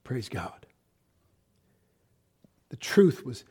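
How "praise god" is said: breathy whispered praise; soft reverent whisper. Real, not acted. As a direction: whisper it in awe. Awed praise.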